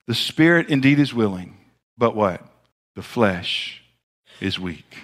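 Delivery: low, soft and gentle, fading to almost nothing; tender. Acted. Jesus tenderly telling weary disciples, low and soft. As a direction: speak it tenderly as comfort. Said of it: "I believe he said this probably with tenderness".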